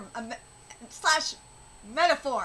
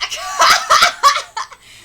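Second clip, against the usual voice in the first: high, bursting laugh; loud laughing; 9 semitones higher, 12 dB louder than usual. Real, not acted. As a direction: crack up loudly. Laughs at own analogy.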